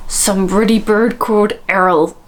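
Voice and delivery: overdone West Country, heavy on the R; Hagrid. Acted. Host copying the clip like a little gremlin, hammed-up West Country. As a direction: badly mimic a West Country film giant. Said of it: "sound like a little gremlin".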